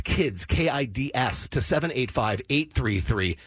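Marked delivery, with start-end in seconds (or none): none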